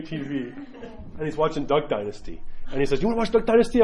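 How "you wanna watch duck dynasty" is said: high-pitched imitation of an eager old monk; monk's excited invitation. Monk inviting visitor to watch TV, high and eager. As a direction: pipe up high, eagerly offering.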